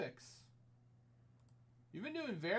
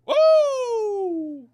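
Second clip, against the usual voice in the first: excited whoop; 15 dB louder than usual. Real, not acted. Rare card pulled on stream.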